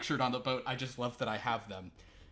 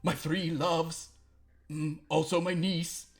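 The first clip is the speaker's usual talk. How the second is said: high, loud, slow lament; hammy self-pitying naval lament; the commodore pours out his four loves; lovelorn commodore; 5 semitones higher, 11 dB louder, slower than usual. Acted.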